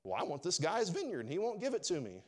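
high, childish mock sulk; hammed-up pouty whine; pouting king. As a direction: complain like a spoiled child denied a toy. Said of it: "He's pouting".

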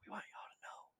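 eerie hushed threat, unvoiced; menacing whisper. Invader whispering fear tactics at a doomed world. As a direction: whisper doom to the whole planet. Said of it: "this dude coming over going".

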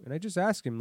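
ordinary talk

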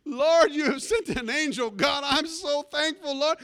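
high, loud cry of relief to heaven; overjoyed thanks. Retold or imitated. Pastor thanks God for sending an angel, loud relieved cry. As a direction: cry out gratefully, hammed-up and drawn out.

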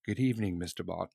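low, soft, understated greeting; the Queen. Retold or imitated. Monarch greeting a spy who walks in, low imitated voice. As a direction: drop low and quiet, imitating a composed royal. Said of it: "and the queen's like".

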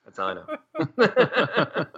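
high, drawn-out laughing delivery; words dissolving into laughter; laughing repeat. Real, not acted. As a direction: stretch it out high through a laugh. Repeats his joke, laughing.

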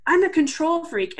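really loud. Retold or imitated. Imitating the woman at the luncheon, really loud. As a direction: imitate words that leap out at you. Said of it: "that would be really loud".

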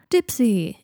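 loud, effusive greeting; gushing hail with a falling tune; delighted exclamation. A narrator's reading. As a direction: exclaim the name like a fond welcome. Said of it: "exclaimed Blaise".

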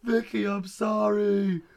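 high, drawn-out shouted apology; mock desperate wail. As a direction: wail it slowly like a hammed-up breakdown. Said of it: "I'm going crazy".

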